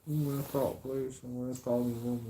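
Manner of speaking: imitating the angry girlfriend; mocking girlfriend. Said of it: "she flipped out and goes".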